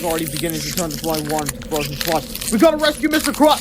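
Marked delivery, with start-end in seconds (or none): 2.5-3.6 put-on urgent kid voice. A narrator's reading. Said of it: "said George".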